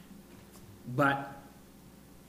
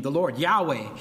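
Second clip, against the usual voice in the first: ordinary delivery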